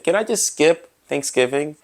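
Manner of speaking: ordinary talk, nothing marked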